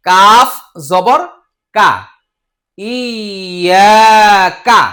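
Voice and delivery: কাফ জবর said at half speed, rising; slow spelling. Shown as a demonstration. Teacher spelling কাফ জবর slowly for learners. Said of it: "এইভাবে দশ বার বলেন".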